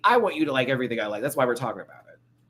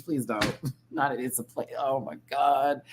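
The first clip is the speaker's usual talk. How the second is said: normal talking voice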